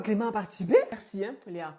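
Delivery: ordinary talk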